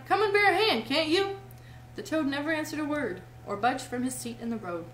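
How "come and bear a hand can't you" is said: high, loud impatient call; calling for help. A narrator's reading. Mole and Rat want help with the cart, loud cry. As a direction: call across for a hand. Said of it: "they cried".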